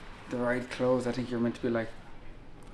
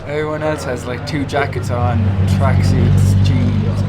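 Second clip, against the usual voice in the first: louder, complaining; 11 dB louder than usual. Real, not acted. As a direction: exclaim it loudly. Complaining about the cold.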